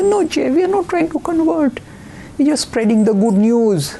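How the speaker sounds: high, sweet reassuring voice; nice cozy minister. Retold or imitated. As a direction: play a cozy, gentle preacher. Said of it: "He said".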